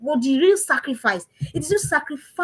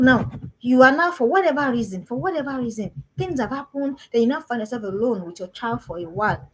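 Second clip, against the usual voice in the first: ordinary delivery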